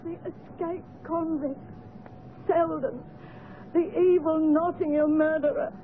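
slow, halting, tearful confession; weeping admission. Acted. Servant admitting the convict is her brother, weeping. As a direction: force it out between sobs, breaking at the end. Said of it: "the weeping unfortunate woman".

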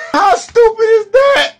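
laughing, loud and high, in disbelief; laughing jeer. Real, not acted. Mocking voters who lost Obamacare, laughing loudly. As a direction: burst out laughing at them.

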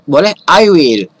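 ordinary delivery

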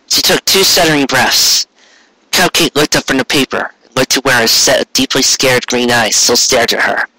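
usual speaking voice

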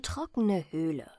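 ordinary delivery

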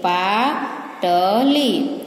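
slow, drawn-out word list, each word set apart; slow read-aloud. Shown as a demonstration. Teacher reading the Gujarati word list to pupils, slow and clear. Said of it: "सारे व्यंजन का उच्चारण करना है".

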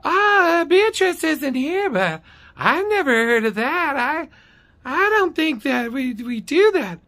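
very high, drawn-out mocking office lady; high caricature brushing someone off; Dee's high-pitched voice. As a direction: imitate an unhelpful clerk in falsetto. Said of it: "She has this high-pitched voice".

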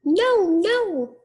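very high squeaky refusal; little pig voice. A narrator's reading. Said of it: "said the little pig".

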